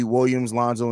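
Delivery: usual speaking voice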